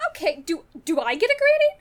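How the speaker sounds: high, loud, offended demand; indignant outburst. Acted. The captain, left out of the greetings, demanding one loudly. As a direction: squeak out the complaint at being ignored.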